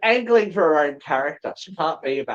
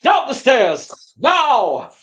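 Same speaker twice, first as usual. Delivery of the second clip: urgent shout; 7 semitones higher, 6 dB louder, much slower than usual. Acted. Character urges escape.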